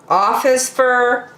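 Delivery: plain reading voice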